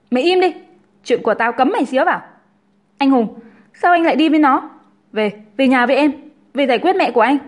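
high, loud, demanding woman; bossy, angry Linh. A narrator's reading. Linh silences her rival and orders Hùng home, angry demand. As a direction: shut the rival down, then order him home.

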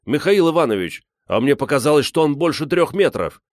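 put-on high, eager boyish voice; excited boy. A narrator's reading. Гриша tells Михаил Иванович the bear's size, excited. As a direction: insist eagerly, pitch up.

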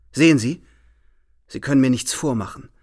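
usual speaking voice

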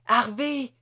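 high, loud urgent cry. A narrator's reading. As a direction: shout his name in alarm. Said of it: "je m'écrie".

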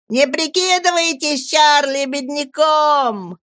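put-on high, slow taunting shout of boys; jeering yell. A narrator's reading. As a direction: yell the mockery at the old man. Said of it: "вопили они".

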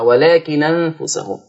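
"ولكن أنفسهم" run together as lakinanfusahum, no glottal stop; naql. Shown as a demonstration. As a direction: recite "ولكن أنفسهم" slowly, the hamza dropped. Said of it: "يبقى النون مفتوحة".

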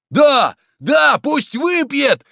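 high, loud bloodthirsty shout; mob yelling. A narrator's reading. Bloodthirsty crowd shouting at the priest, yelling. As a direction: roar for him to drink. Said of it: "орала толпа".

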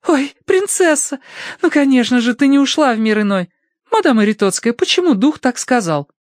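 put-on very high girlish voice; Tossie's voice. A narrator's reading. Tossie finds her cat alive, high girlish voice. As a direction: voice the girl far above your own.

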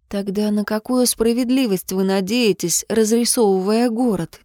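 ordinary delivery, nothing marked

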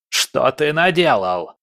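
slow, drawn-out cry of outrage; villain's cry. A narrator's reading. Trapped villain demanding answers from his foe, crying out. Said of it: "заорал он".

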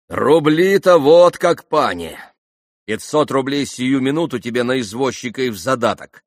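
loud, high, urgent promise; crying out. A narrator's reading. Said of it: "крикнул Митя". (горячий выкрик)

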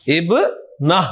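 ابنہ in two separate parts, ib, then nah; final h sound. Shown as a demonstration. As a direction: pause inside ابنہ and end it on h. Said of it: "آپ نے ہا کی آواز نکالنی ہے".

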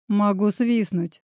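ordinary delivery

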